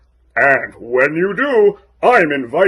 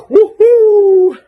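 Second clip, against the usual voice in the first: excited cheer; 6 dB louder than usual. Acted. Cheer after the song.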